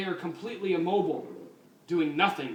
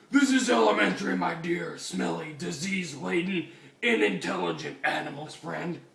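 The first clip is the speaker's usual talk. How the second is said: smug lecture; 4 semitones higher, 5 dB louder than usual. Acted. Batman lecturing hippie loudly.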